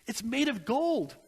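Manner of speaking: spiral into frantic guilt; desperate regret. Retold or imitated. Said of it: "He says".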